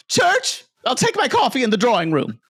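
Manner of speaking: high, fast, lofty declaration; grand society lady. Pretend. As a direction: proclaim it like a rich heiress. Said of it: "You will hear me say that".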